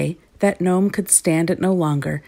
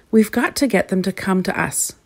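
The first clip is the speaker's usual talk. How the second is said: plain reading voice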